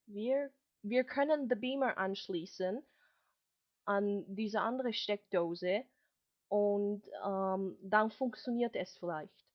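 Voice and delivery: mock classroom German with English accent creeping in; British American German mix. Shown as a demonstration. Recreating her embarrassing mixed-accent slip in class. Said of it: "it sounded about like this. I'll try".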